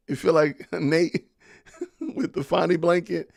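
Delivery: real chuckle breaking into the words; laughing while talking. Real, not acted. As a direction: barely hold back the laugh, stretching words out. Cracking up at a joke.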